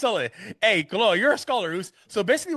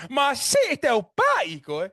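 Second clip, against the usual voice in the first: hyped catchphrase; 9 semitones higher, 3 dB louder, much slower than usual. Shown as a demonstration. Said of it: "See the famous phrase".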